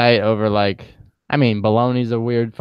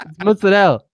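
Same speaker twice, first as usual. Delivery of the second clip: Italian pronunciation; 6 semitones higher, 3 dB louder than usual. Retold or imitated. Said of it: "I was like".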